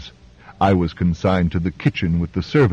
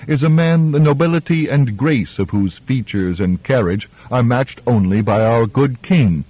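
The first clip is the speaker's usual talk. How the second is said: ordinary delivery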